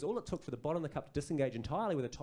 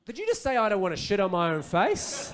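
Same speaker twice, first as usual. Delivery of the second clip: high, loud mock disbelief; incredulous question; 6 semitones higher, 7 dB louder than usual. Colleague questioning the host's blurt, mock incredulous. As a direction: query it loudly, voice pitched up. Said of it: "then someone else goes".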